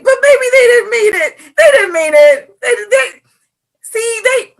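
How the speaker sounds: high, loud pleading in mock distress; whiny excuse-making. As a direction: plead their case in a squeaky, overwrought voice. Own excuses acted out.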